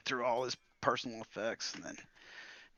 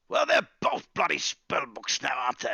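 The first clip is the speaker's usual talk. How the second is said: contempt; 4 semitones higher, 4 dB louder than usual. Acted. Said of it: "replies with contempt".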